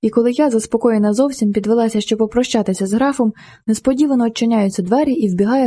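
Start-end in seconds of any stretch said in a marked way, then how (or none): none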